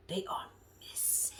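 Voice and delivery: breathy whispered emphasis. Shown as a demonstration. Recapping a reality show, whispering for drama. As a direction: drop to a secret whisper. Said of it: "When I say they are missing".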